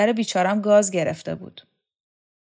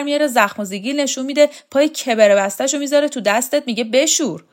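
fast, higher, louder indignant rant; fed-up retort; 6 semitones higher, 7 dB louder, faster than usual. Acted. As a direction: rattle off the grievances.

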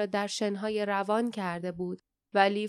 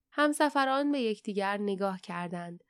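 ordinary delivery, nothing marked

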